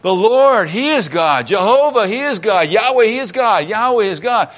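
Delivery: high, loud jubilant proclamation; loud awestruck crowd crying out; crowd acclaiming. Retold or imitated. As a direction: shout it in triumph. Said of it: "all the people went".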